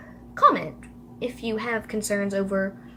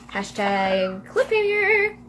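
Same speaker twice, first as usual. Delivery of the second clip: mock-dramatic; slower than usual. Storyteller ends the chapter, mock-dramatic drawn-out announcement. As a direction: draw it out in a jokey sing-out.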